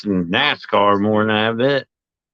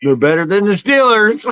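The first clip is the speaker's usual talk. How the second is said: gleeful taunt; 11 semitones higher, 5 dB louder than usual. Real, not acted. Teasing a rival team's fans.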